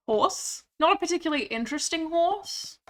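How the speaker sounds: high gasp, then bright dry letdown; delighted at spotting a horse; excited gasp. Real, not acted. Spots a horse in game.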